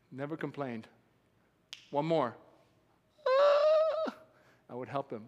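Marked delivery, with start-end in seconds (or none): 3.3-4.1 a squealing pained yell; mock workout scream; high strained grunt of effort. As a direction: groan like lifting too heavy. Friend straining during workout.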